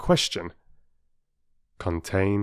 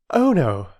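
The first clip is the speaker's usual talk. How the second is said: bright; 8 semitones higher, 5 dB louder than usual. A narrator's reading. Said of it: "Mother said brightly".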